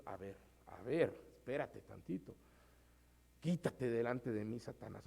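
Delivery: low, soft stern rebuke; a quiet, firm put-down; Jesús reprendiendo. Retold or imitated. Jesús responde a Pedro. (Jesús, bajo y suave, reprende con firmeza)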